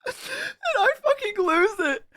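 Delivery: very high voice squeezed through laughter; laughing helplessly. Real, not acted. Streamer admits to chat he lost it, helplessly laughing. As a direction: get the words out mid-cackle. Still cracking up at his story.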